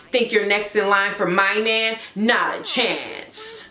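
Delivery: burn her, cold and condescending; Gina. Acted. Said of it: "Gina is a raging B I T C H".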